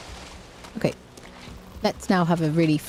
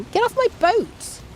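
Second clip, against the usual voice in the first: much higher, shrill-free outraged shout; high indignant yell. Real, not acted. Yelling at a stranger to get off the boat. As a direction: shoo them off your boat, higher.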